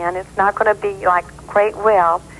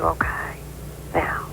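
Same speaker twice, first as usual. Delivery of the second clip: whispered; 3 semitones lower, 9 dB quieter, slower than usual. Real, not acted. Psychic tuning in softly.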